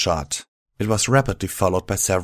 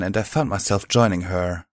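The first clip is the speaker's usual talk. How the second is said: plain reading voice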